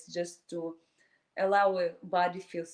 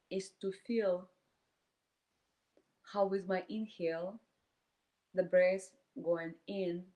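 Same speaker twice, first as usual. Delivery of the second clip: calm meditation voice with long silent pauses; guided meditation. Acted. Teacher leading a group through inhale and exhale, guided meditation. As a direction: guide them breath by breath, leaving space between phrases.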